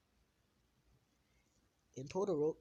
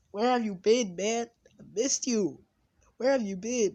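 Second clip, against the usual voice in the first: mock crying; 8 semitones higher, 10 dB louder than usual. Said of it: "he's, like, crying".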